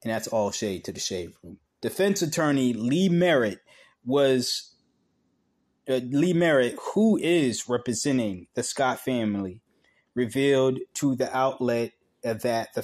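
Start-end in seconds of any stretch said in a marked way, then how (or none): none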